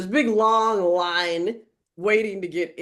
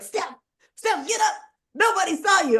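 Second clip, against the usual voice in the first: urgent coaxing; 10 semitones higher than usual. Retold or imitated. Friend to fallen woman, get up, urgent high-pitched coaxing. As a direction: imitate a friend anxiously hurrying someone up.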